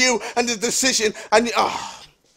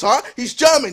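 ordinary delivery

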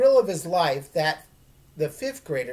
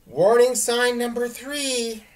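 higher, louder and slow, solemn intoning; intoned; 8 semitones higher, 7 dB louder, slower than usual. Acted. [name] warns her friend, mock solemn intoning. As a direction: announce it like an ominous warning. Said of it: "I intoned".

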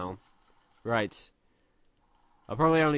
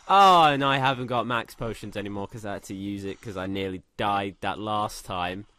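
ordinary talk

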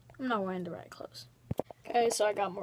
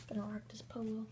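whisper; 11 dB quieter than usual. Real, not acted. Whispering to himself about polo.

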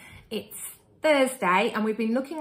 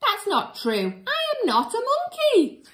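high indignant denial; mock protest; 14 semitones higher, 5 dB louder than usual. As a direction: squeal the denial in outrage. Reader protests.

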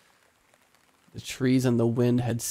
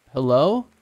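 calling down the well, louder but unsure; a raised, hesitant hello into the dark; 3 semitones higher, 6 dB louder than usual. A narrator's reading. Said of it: "I called out again louder, but still as hesitant".

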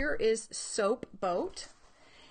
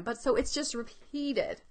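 normal talking voice

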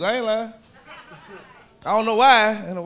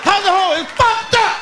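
mock yell; 10 semitones higher than usual. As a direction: yell it like an outraged kid. Mock kid shouting about homework.

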